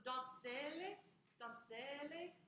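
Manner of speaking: slow "donzelle" with every syllable sounded, rising at the end; Italian pronunciation demo. Shown as a demonstration. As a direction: model "donzelle" for the class to repeat. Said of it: "Say that a few times".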